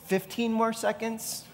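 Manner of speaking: high, breezy, unbothered answer; a light mock shrug of an answer; mock casual reply. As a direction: toss it off lightly. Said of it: "You're not looking for".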